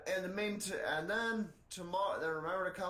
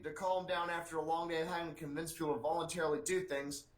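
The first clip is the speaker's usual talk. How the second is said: normal talking voice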